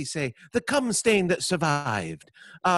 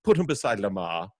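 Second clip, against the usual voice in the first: posh hostess voice; 6 semitones lower than usual. Pretend. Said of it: "You go".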